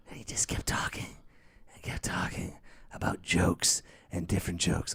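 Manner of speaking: whispered aside. Real, not acted. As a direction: drop to a whisper. Whispered aside about plumber.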